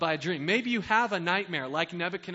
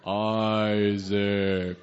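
deep, booming God voice; 9 semitones lower, 3 dB louder than usual. Acted. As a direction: call down as God from the heavens. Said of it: "I've been working on my God impression".

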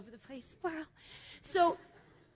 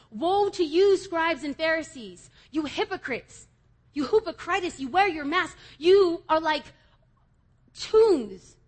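louder, higher thundering rebuke; Jesus rebuking; 5 semitones higher, 9 dB louder, slower than usual. Acted. Preacher voicing Jesus as he denounces the Pharisees, loud and high. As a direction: call them out like Jesus to the Pharisees.

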